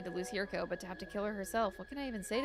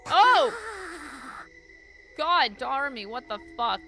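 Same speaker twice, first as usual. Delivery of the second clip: sudden loud startled cry; startled shout; 4 semitones higher, 9 dB louder, slower than usual. Real, not acted. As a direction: shout it out in shock. Bursting into the room.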